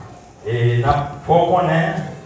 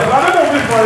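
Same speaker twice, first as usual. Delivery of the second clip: high, raised call; 12 semitones higher, 5 dB louder than usual. Real, not acted. Preacher prompting the church to be louder, high insistent call. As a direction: urge the church to speak up. Calls congregation to speak up.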